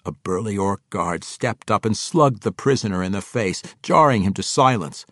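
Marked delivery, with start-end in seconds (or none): none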